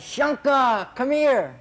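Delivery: high, loud summons; calling out. Retold or imitated. Guru stops the walk to summon his gardener, calling out.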